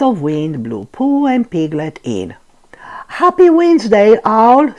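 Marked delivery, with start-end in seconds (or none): 3.2-4.7 excited. A narrator's reading. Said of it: "said Pooh".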